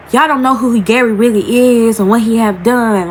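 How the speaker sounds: normal talking voice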